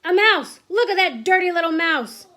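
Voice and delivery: loud, alarmed yell. A narrator's reading. Man spotting a mouse in the kitchen, yelling. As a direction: raise the alarm at full voice. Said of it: "I heard a man's voice yelling".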